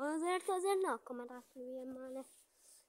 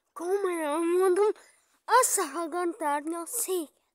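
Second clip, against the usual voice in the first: frustrated outburst; 4 semitones higher, 15 dB louder than usual. Real, not acted. Frustrated threat after whisper.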